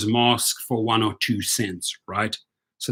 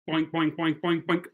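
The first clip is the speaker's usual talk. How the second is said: cartoon boinks; 3 semitones higher, 8 dB quieter than usual. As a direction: tap out soft cartoon bonks. Hammer hits, sound effect.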